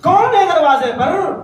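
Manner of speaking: high, loud sharp challenge from behind the door. Acted. Mother calling through the closed door, sharp and loud. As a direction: snap the question out high. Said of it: "بڑے تیکھے لہجے میں آواز آئی".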